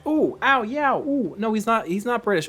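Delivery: loud, high yelps of pain from a cramping foot; ow it out, the foot is seizing up; real foot cramp pain. Real, not acted. Streamer yelping at viewers over a foot cramp. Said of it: "My foot's cramping".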